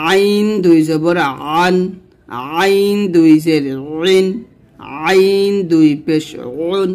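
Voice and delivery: আইন drawn out from the throat; slow Arabic Ain drill on আইন; pressed Ain. Shown as a demonstration. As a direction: squeeze আন, ইন, উন in the throat. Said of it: "এরকমভাবে উচ্চারণ করবেন".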